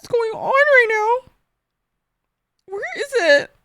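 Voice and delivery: high, slow, hammed-up wail; mock wail. Streamer wailing at chat in mock despair. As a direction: stretch it out like a dramatic sob.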